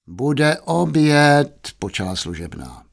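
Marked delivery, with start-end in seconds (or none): 0.1-1.7 slow, drawn-out raised call; maid calling. Acted. Maid calling the young master to lunch, drawn-out call. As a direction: draw out the announcement, pitched up.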